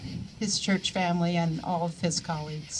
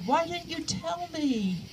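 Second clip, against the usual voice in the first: soft, higher, slower, a touch of hurt in it; gently hurt question; 9 semitones higher, 6 dB quieter, slower than usual. Retold or imitated. [name] quoting Rico's hurt question, soft and slow. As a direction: ask it as if quietly wounded. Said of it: "I could tell he was really heartbroken".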